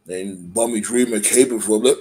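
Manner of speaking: ordinary delivery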